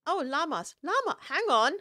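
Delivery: delighted jump up in pitch at spotting llamas; excited. Real, not acted. Player spots llamas, calling out excitedly.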